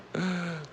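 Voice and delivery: sharp, breathy pretend gasp; mock gasp. Host reacting to his favourite car losing, mock gasp. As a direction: gasp like it hurts, playfully.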